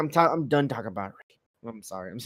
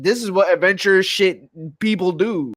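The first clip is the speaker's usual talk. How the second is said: mock lecturing; 7 semitones higher, 8 dB louder than usual. Speaker lecturing the crying girl.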